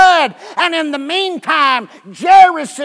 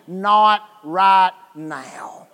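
calm; 5 semitones lower, much slower than usual. Acted. God's calm answer.

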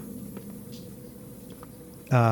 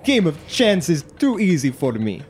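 boastful warrior, high and theatrical; hammed-up conceited contestant voice; Testicles; 7 semitones higher than usual. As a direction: brag in a put-on foreign-sounding accent. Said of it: "you hear him go".

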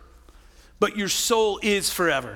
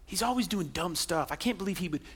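low, softer scornful mutter under the breath; inner grumbling; 5 semitones lower, 10 dB quieter than usual. Retold or imitated. Wife silently judging her husband, a low scornful mutter. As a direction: grumble it to yourself, not meant to be heard. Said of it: "in your heart you start going".

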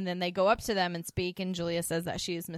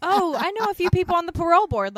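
smug charmer quote; 11 semitones higher, 11 dB louder than usual. Retold or imitated. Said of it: "he was like".

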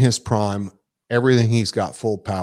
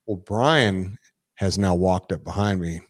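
ordinary talk

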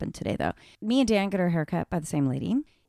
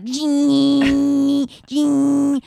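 buzzy imitated clipper noise, louder; mock trimmer buzz; 5 semitones higher, 6 dB louder than usual. Wife imitating husband's trimmers, loud mock buzz. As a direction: make the trimmer noise with your voice.

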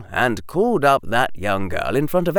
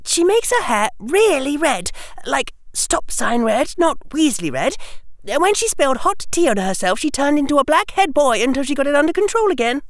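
put-on much higher, quick girl's voice; chatty schoolgirl; 4 dB louder, faster than usual. Hermione tells Harry about a classmate, high and chatty. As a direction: share news fast in a high-pitched voice.

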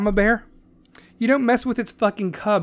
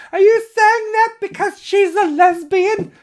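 mock heckler voice; 12 semitones higher, 5 dB louder than usual. Imagined heckler objecting.

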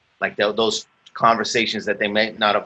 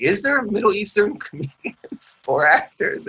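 laughing question; 11 semitones higher than usual. Retold or imitated. Retells his own hopeful question.

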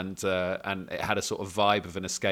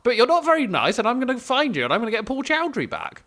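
high, prim Thatcher-style voice; lofty stern Thatcher threat; Margaret Thatcher impression; 13 semitones higher, 7 dB louder than usual. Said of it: "doing a Margaret Thatcher impression".

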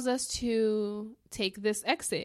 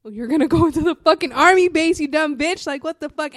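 loud, higher self-scolding, half shouted; scolding myself; 4 semitones higher, 9 dB louder than usual. Retold or imitated. Driver yelling at herself while heading into the army base, loud scolding. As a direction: yell at yourself for a dumb move. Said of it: "I just realize".

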